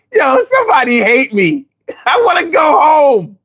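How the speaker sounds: mock whining. Recalling the cold frat basement, mock crying to go home. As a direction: whine like a homesick kid. Retold homesick misery.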